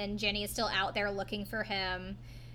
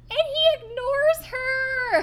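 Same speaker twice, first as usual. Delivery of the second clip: outraged; 9 dB louder, much slower than usual. Real, not acted. Outraged retelling.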